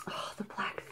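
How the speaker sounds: a real admiring whisper. Real, not acted. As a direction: breathe it out in wonder. Admiring watch face closely.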